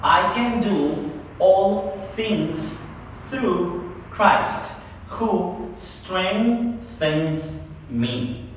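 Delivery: very slow, phrase-by-phrase reading; slow drill of the verse; part by part. Shown as a demonstration. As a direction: stretch each chunk of the verse slowly. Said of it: "Una vez más".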